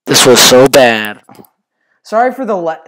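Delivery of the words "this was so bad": loud, slowed-down complaint. Real, not acted. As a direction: groan it out loudly. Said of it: "sorry for being really loud".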